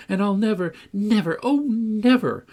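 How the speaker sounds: an impassioned promise building up; fervent vow. A narrator's reading. Cathy vows fervently.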